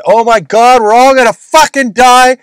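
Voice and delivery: high, loud, panicked wail about going broke; mock panic. Past self panicking over rising material prices, loud, high mock panic. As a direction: play the frantic freak-out, shouting in alarm. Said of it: "I started flipping out".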